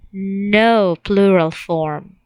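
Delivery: "No plural form" slow, with "No" hit firmly; slow down and punch "No" in "No plural form"; stress on no. Shown as a demonstration.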